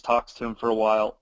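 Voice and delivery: normal talking voice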